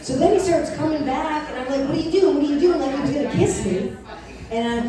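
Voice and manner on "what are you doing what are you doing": higher, urgent retold protest; mock alarm. Woman to a man coming in to kiss her, mock alarm. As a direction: act out fending off an unwanted advance.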